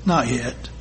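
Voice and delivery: a kind potter quietly refusing; soft low steady "not yet"; gentle firm master. A narrator's reading. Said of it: "just smiled and said".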